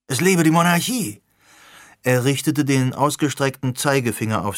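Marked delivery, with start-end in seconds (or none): 0.1-1.2 higher and louder rallying cry; cheering. Acted. Alex, fired up, cheering the new party plan in a higher, louder rallying cry. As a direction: proclaim it like a victory toast.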